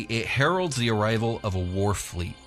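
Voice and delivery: usual speaking voice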